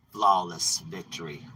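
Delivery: low, slow announcer call; a hint of an arcade announcer; lightly voiced game announcer. Demons gloat over another victim like an announcer. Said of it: "for them, they go".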